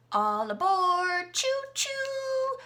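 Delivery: high, loud all-aboard call; playful train conductor shout with a high whistle. Acted.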